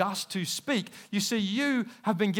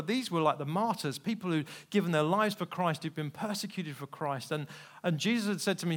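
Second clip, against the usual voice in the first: ordinary talk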